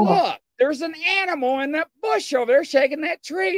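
high-pitched, urgent pointing-out; imitated excited alert. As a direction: play the companion excitedly spotting something. Companion spots animal in bush.

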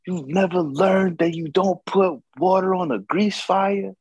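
high incredulous scolding; mock the spouse's exasperated telling-off. Spouse scolding over grease fire.

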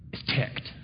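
hushed, ominous aside; whisper. Acted. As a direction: drop to a breathy whisper. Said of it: "dramatic whisper".

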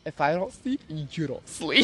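measured, menacing re-enactment; quiet threat. Retold or imitated. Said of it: "And I'm like".

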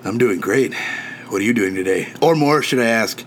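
seductive man. Acted. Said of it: "do a dramatic reading of your last Tinder conversation".

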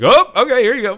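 very high, loud squeaky giving in; cowed magician. Retold or imitated. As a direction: squeal it out like a scared cartoon crook, laughing. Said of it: "He's like".

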